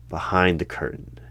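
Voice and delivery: usual speaking voice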